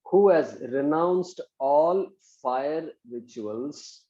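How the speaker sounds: slow, spaced-out dictation. Shown as a demonstration. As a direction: dictate slowly for students to write.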